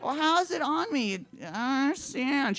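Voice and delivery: put-on high-pitched drunken whine; hammed-up drunk bewilderment; bewildered wail. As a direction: act out helpless confusion, voice way up high. Drunk, bewildered by vomit on pants.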